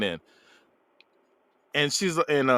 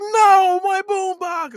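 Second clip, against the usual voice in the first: very high, loud mock wail; hammed-up high-pitched outcry; mock distressed yell; 5 dB louder, much slower than usual. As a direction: cry out way up high as if losing it. Retold panic over soaked boombox.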